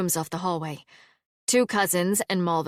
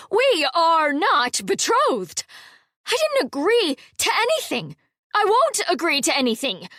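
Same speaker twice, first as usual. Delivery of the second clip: furious; 10 semitones higher, 5 dB louder than usual. A narrator's reading. Halla rejecting her cousin's betrothal claim, furious. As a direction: refuse him outright, indignant.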